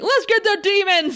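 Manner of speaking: rally the troops, hammed up; gung-ho soldier yell. Mocking Riley's battle cry to co-host. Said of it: "he's like".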